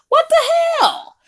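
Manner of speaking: high, slow, stretched what the hell; shocked and fed up; outraged disbelief. Retold or imitated. Outburst at the boy.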